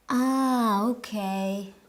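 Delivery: "okay" accepting bad news; resigned okay. Shown as a demonstration. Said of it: "Intonation matters. Listen to this".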